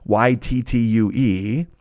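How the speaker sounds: slow, letter by letter code; spelling slowly. Shown as a demonstration. Host reading Uber code to listeners, slowly spelled. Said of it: "Now, this is all lowercase".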